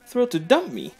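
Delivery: bitter sneer. Acted. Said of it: "I don't know why I said it like that".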